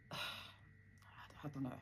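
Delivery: sighed, whispered and very slow; deflated sigh. Retold or imitated. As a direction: let the air out like all hope is gone. Dad looked deflated.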